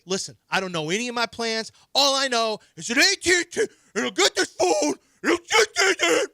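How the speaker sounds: building from loud to a high cracking yell; retold yelling. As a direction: rant at the phone company, rising to a screech. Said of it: "and I'm yelling".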